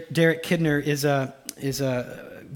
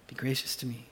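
soft plea; 5 semitones lower, 12 dB quieter, faster than usual. Retold or imitated. David's plea at the bottom.

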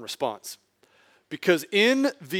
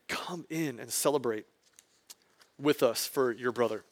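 quiet, gentle invitation; father softly begs the older son to join the feast; calm plea; 4 semitones lower, 9 dB quieter than usual. Retold or imitated. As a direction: softly urge him to come in. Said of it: "he pleads with him with calm, with composure".

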